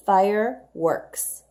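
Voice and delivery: fireworks said slowly, syllable by syllable; slow model read. Shown as a demonstration. Teacher sounding out fireworks for children. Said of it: "Here's another compound word".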